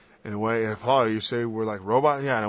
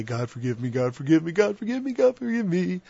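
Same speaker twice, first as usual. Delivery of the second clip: climbing higher with each forgive me; mock pleading; 6 semitones higher than usual. Mocking a careless sinner, pleading ever higher. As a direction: ham up a repeating plea.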